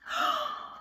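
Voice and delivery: sharp storyteller's gasp; astonished gasp. A narrator's reading. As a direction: gasp as if spotting something startling. Peddler looks up, sees monkeys.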